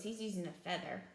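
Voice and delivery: ordinary delivery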